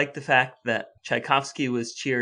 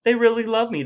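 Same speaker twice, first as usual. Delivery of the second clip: much higher, delighted mock gush; gleeful Tchaikovsky; 9 semitones higher, 3 dB louder than usual. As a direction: gush like a flattered composer who feels loved. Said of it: "He came out of this thinking".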